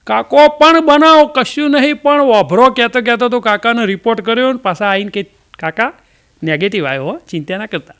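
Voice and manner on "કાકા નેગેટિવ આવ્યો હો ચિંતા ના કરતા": low, soft reassurance; calm the old man down gently; reassuring hospital worker. Retold or imitated. Hospital worker tells the old man his test is negative, softly.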